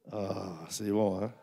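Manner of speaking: soft, slow, savoring Ah, c'est bon; quiet savoring. Shown as a demonstration. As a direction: murmur Ah, c'est bon slowly, as if tasting something. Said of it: "Dites, «Ah, c'est bon.»". (Ah, c'est bon murmuré, lent et savouré)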